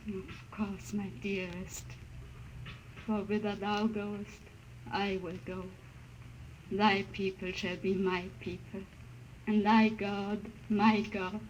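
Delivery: tender vow. Acted. Wife's devoted vow before death.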